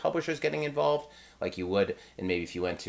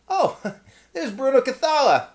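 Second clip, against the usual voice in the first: high, louder gasp of recognition with a laugh; starstruck surprise; 6 dB louder than usual. Retold or imitated. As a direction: spot a famous designer and blurt it out. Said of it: "and I'm like".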